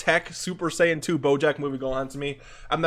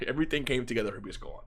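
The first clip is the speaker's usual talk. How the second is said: "everything came together for Beast Gohan" said again softer and fast, half-laughing; a sheepish softer rerun of the line; softer, quick repeat; 3 semitones lower, 7 dB quieter, much faster than usual. Shown as a demonstration. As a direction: take it back down, softer and quick. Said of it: "I'm sorry".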